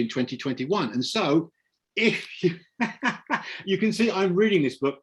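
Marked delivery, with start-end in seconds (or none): 2.8-3.6 short burst of laughter. Real, not acted. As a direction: break into a brief chuckle mid-sentence. Amused at reading from the book.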